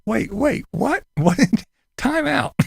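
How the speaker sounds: high, incredulous "wait, what?"; startled judge. Retold or imitated. Judge cutting off the witness, high and incredulous. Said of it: "The judge was like".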